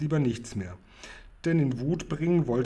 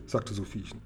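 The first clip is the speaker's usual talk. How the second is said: usual speaking voice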